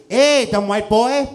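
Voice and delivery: loud, high mock Vietnamese accent; scolding in an imitated Vietnamese accent, drawn out; high, slow, put-on Vietnamese accent; Vietnamese friend. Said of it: "I actually have a Vietnamese friend".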